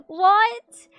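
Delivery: squeaky high shocked yelp; sudden loud shocked what. Real, not acted. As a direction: blurt it out high and loud in disbelief. Shocked by a friend's confession.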